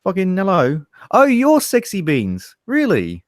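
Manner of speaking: high-pitched South London mate; South London accent. Acted. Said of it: "from South London".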